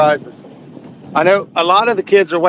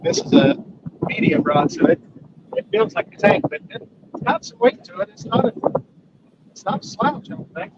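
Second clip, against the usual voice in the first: strained, breathy while reeling; winded and excited mid-fight; fighting a fish; 7 semitones higher, 4 dB quieter than usual. Real, not acted.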